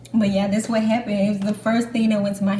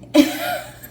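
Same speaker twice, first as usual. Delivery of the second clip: high, loud giggle; real laugh at her own memory; burst of laughter; 5 dB louder than usual. Real, not acted. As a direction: laugh out at herself.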